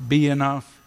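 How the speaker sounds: ordinary talk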